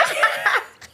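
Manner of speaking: high, unvoiced burst of laughter; sudden high airless laugh; high breathless laugh. Real, not acted. Laughing about crush's new look.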